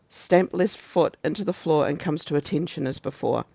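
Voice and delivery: ordinary delivery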